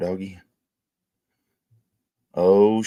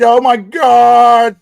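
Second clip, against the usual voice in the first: slow, high, loud "oh my God"; loud stunned gasp of words; drawn-out shock; 10 semitones higher, 9 dB louder, much slower than usual. Real, not acted. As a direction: stretch it out in disbelief. Reacting to a suspended stream.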